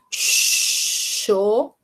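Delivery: "chocolate" extremely slow, long pauses between syllables. Shown as a demonstration. Teacher gives a child pieces to join, separate slow syllables. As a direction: stretch "chocolate" into cho, co, la, te. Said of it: "se eu falar pra ela assim".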